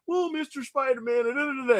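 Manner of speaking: mock sucking up. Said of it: "And he's like".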